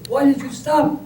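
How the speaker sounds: high, puzzled woman's question; high wife voice. Acted. Wife asks husband why he stopped, puzzled high voice.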